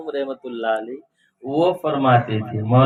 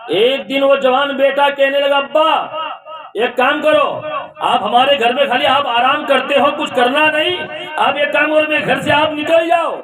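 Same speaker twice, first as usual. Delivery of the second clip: high, loud storytelling sermon; preacher voicing the harsh son; fiery preaching; 11 semitones higher, 4 dB louder than usual. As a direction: preach it out with rising fervour. Preaching the story at full force.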